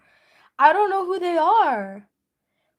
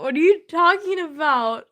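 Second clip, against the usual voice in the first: ordinary talk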